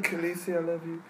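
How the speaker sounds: soft lovesick dragon voice. Pretend. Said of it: "this is my dragon".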